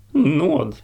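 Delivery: high frightened squeak for mercy; tiny terrified peep; squeaked plea. A narrator's reading. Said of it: "pep Fjolvar".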